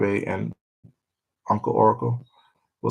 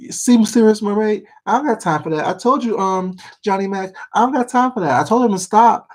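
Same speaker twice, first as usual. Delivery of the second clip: imitating Tracy; 12 semitones higher, faster than usual. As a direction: squeal it out like Tracy going off. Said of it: "she be like".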